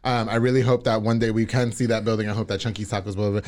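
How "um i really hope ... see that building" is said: ordinary talk, nothing marked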